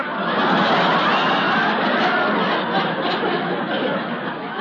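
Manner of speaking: high breathy nervous giggle; embarrassed giggle. Real, not acted. Caught out, embarrassed laugh.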